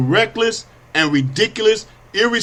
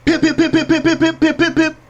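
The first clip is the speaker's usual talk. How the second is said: high, rapid, even pip sounds; high-pitched volley of vocal gun pips; imitated gunfire; 10 semitones higher than usual. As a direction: fire off a burst of mouth-made shots.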